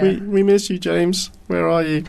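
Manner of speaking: high, mock-plaintive call; mock pining. Host calling to the missing co-host, mock pining.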